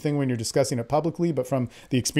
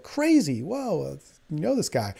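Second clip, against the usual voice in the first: amazed; 4 dB quieter than usual. Retold or imitated. Retold amazed reaction.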